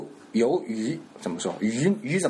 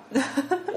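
高声笑; 13 semitones higher than usual. Real, not acted. 笑着准备模仿.

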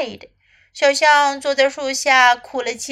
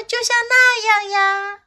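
high-pitched storybook snake; Snake's voice; 9 semitones higher than usual. A narrator's reading. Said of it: "蛇说".

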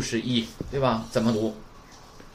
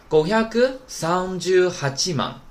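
五百 三十八万 in Japanese, "gohyaku sanjū hachiman", high and drawn out; slowly chain 五百 三十八万 in Japanese, pitched up; linked up slowly; 5 semitones higher, slower than usual. Shown as a demonstration. Teacher joins the parts for students, 五百 三十八万 slow and high. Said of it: "那么连上就可以了".

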